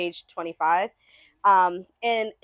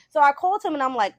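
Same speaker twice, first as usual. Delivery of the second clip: ordinary talk, nothing marked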